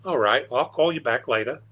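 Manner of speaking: playful put-on Australian accent, lilting. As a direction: imitate an Aussie friend ending a call. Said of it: "that's my best Australian accent".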